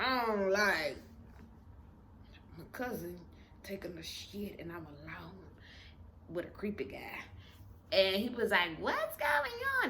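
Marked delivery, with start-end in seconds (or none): 2.6-7.2 hushed whisper; softer, secretive whisper. Retold or imitated. Phone call about creepy man. 8.8-9.9 much higher, slow questioning voice; lightly voiced friend asking; high, drawn-out question; friend's voice. Friend on the phone asks.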